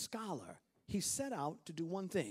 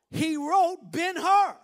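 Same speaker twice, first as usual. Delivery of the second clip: slow, loud, high reveal; triumphant shout; 10 semitones higher, 15 dB louder, slower than usual. Real, not acted. Preacher revealing the Ben-Hur author to the congregation, shouting. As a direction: land the punchline with a shout.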